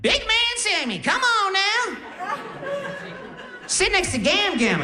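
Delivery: put-on very high, brash Def Jam comedy accent; grandma's crazy accent. Grandma on morphine greeting her grandson, high brash comic accent. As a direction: imitate a Cat Williams style accent. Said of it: "talking in a crazy accent".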